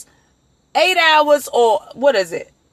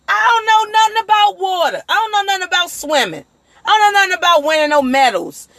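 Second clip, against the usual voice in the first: emphatic protest; 6 semitones higher, faster than usual. Real, not acted. Protesting he knows nothing.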